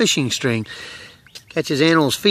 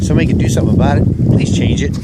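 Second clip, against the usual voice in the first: louder, fast, fed-up outburst that ends in a breathy plea; aggravated plea; 8 dB louder, much faster than usual. Real, not acted. Hiker tripping on grass netting, pleading loudly and fast in exasperation. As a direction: vent the frustration, then beg. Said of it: "it's getting aggravating".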